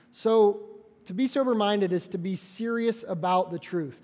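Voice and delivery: ordinary talk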